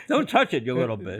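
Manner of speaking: laughing through a mock scold; playful laughing rebuke. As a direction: tease it with a laugh. Jokey quote with a laugh.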